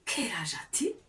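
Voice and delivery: whispered model question. Shown as a demonstration. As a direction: whisper "Quel âge as-tu?" without voice, rising at the end.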